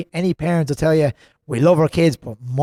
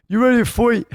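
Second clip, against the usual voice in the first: high-pitched Dublin accent imitation; 9 semitones higher than usual. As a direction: imitate a Dublin fighter's challenge. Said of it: "in his Dublin accent".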